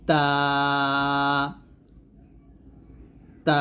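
long-held thin তা; পাতলা তা. Shown as a demonstration. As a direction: model তা lightly, drawing out the vowel. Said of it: "এরকম". (তা পাতলা করে টেনে)

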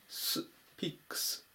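ス、ピックス with an unvoiced s, "s, piks"; ス、ピックス ending in s, never z; voiceless s. Shown as a demonstration. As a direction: isolate the hissed ス, then say ピックス. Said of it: "このSも濁らずに".

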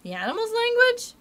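high, loud incredulous question; astonished cry. A narrator's reading. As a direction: cry it out in amazement. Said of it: "I cried".

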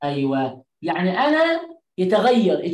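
ordinary talk

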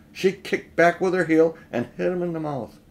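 usual speaking voice

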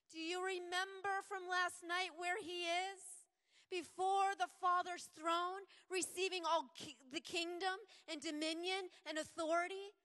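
preacher proclaiming Christ on the throne; full-force preaching. Real, not acted. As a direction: proclaim it over the room.

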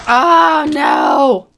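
"Oh" shot high and loud, "no!" dropping; loud dismayed cry. Real, not acted. Trick fails, dismay.

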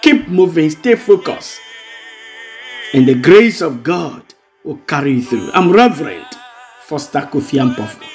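ordinary talk, nothing marked

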